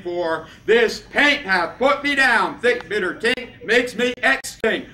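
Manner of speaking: slow, loud poetic declamation; performance-poet climax, loud and drawn out; loud declaiming. Acted. Poem building to its climax.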